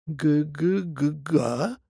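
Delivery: stammered, outraged babble; indignant stammer. A narrator's reading. Old grandfather hill, stunned, stammering indignantly. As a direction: stutter out a stunned protest. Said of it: "в возмущении пролепетал".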